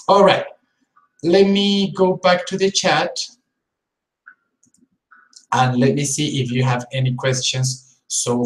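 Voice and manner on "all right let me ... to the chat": ordinary delivery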